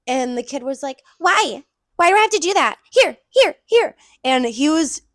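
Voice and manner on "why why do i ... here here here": put-on higher, louder whiny kid voice; high-pitched fake voice. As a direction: whine it like a bratty ten-year-old. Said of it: "using a very high-pitched fake voice".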